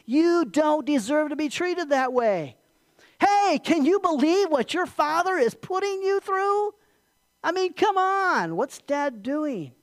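high, drawn-out, incredulous complaint; Satan goading Jesus. Acted. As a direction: sow doubt with exaggerated outrage. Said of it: "Satan lied to, to Jesus".

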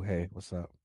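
low, unhurried chat-up voice; demonstrating a smooth, slowed-down pickup line. Shown as a demonstration. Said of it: "I'm really good with my voice".

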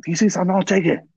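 high put-on Chinese accent. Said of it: "talking to her, like, uh, Chinese accent".

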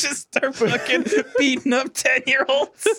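high voice breaking into laughter; laughing. Real, not acted. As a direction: tell it while cracking up. Cracking up retelling a scene.

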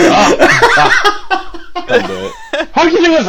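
high laughing ahs in a Clough voice; half-laughing Clough noises; mock Clough, breaking into laughter; Clough impression. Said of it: "it's like Clough impression".

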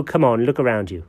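ordinary talk

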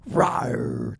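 loud stadium roar; Panther roar. Pretend. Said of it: "I'll go".

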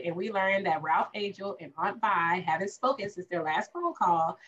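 ordinary delivery

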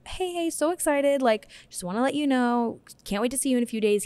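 high-pitched, upbeat greeting voice; excited client message. Acted. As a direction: voice a friendly, excited note to a client, starting high and easing down. Voicing own excited client email.

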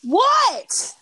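high, loud excited reaction. Real, not acted. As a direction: jump in, thrilled and curious. Excited reaction to celebrity news.

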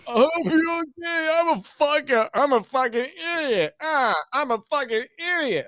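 put-on high, tearful drunk apology; mock bawling. Crying colleague apologising to the driver, mock sobbing. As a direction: blubber out a drunk sorry. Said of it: "starts bawling".

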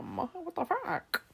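high, slow mock-child complaint; imagined daughter. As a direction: whine it high and drawn out like an appalled kid. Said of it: "She's gonna be like".

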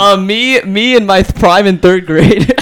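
high, slow joking brag; laughing. Real, not acted. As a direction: ham it up laughing. Self-mocking joke.

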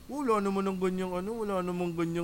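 dopey round-vowelled commenter voice; mocking basher. Comedian mimics bashers on YouTube comments, mocking. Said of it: "yung mga basher".

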